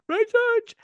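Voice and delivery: very high, silly squeal; mock echo. Host echoing co-host's joke after laughing, silly high-pitched squeal.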